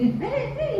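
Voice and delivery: very high, softened imitation yelling; squeaky half-voiced holler; mock yell. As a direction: mimic hollering at a child without real volume. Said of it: "이렇게 소리 지른 적 없어요".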